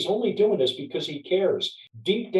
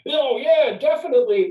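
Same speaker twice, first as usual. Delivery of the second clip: higher, louder, hammed-up eager salesman; mock salesman; 10 semitones higher, 6 dB louder than usual. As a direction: play a gushing salesman agreeing too fast. Mocking a pushy sales pitch.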